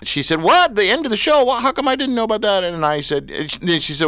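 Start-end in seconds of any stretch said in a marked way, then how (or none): 0.4-2.6 high-pitched retold disbelief; imitated surprise. Friend hearing about the end of the show, imitated surprise. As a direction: act out a friend caught off guard.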